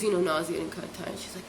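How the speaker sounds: ordinary talk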